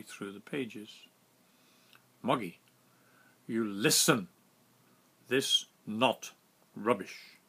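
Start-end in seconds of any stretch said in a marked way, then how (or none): 2.2-4.3 a hint of a louder, stern captain in broken English. Captain scolding the narrator, stern broken English. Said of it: "In his broken English". 5.3-7.4 louder, insistent "This not rubbish" in broken English. Retold or imitated. Captain correcting the narrator, insistent broken English. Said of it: "In his broken English".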